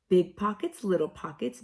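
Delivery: plain reading voice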